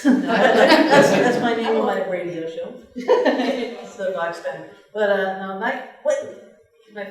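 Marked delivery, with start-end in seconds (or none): 0.0-4.6 laughing and louder. Real, not acted. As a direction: laugh while explaining. Laughs about own title.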